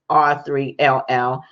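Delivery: ordinary delivery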